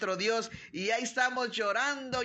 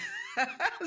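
short high laugh; a real burst of laughter; quick giggle before the blessing; 14 semitones higher than usual. Real, not acted. Laughing at a prayer request.

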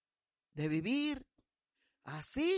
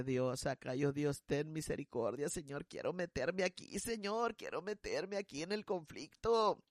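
much softer, hushed plea to God; whispered prayer; 5 semitones lower, 8 dB quieter than usual. Retold or imitated. Pastora quietly praying to God while overhearing the fight. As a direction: pray under your breath, afraid.

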